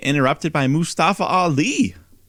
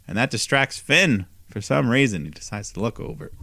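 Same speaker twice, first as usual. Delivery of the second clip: usual speaking voice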